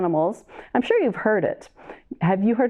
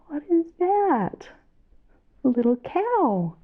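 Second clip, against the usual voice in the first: put-on reading to a toddler; 6 semitones higher, slower than usual. Asks children during song.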